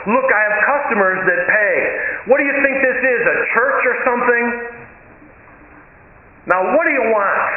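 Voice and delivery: impatient cook raising his voice; getting loud. Retold or imitated. Greasy cook scolds the woman for not ordering, loud and impatient. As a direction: push her to order or get out. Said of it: "he got real loud about it".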